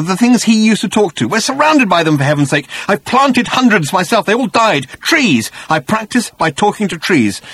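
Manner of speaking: high, agitated outburst; overwhelmed, bewildered. A narrator's reading. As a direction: rant it out as the word finally comes. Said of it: "sudden access of emotion and bewilderment".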